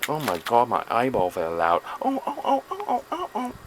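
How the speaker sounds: high squealing oh-oh-oh after a mock cry; hammed-up eyeball panic, squeaking. Vlogger hamming up a lost eyeball, squealing mock panic.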